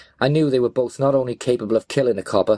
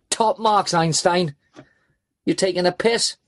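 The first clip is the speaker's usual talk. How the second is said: raised-pitch, aggressive sarcasm; snarling; 7 semitones higher, slower than usual. A narrator's reading. Gunman prodding the driver with his weapon, snarling. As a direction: snarl it as a threatening challenge. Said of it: "Raza snarled a question".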